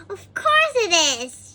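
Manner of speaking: Little Miss Giggles laughing again; giggling. A narrator's reading. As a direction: let the giggle burst through. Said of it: "she giggled".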